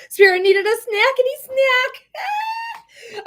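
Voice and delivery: high, slow, squealing joke voice; silly squeal. Real, not acted. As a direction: stretch it out in a giddy high squeal. Jokes after TikTok crashes.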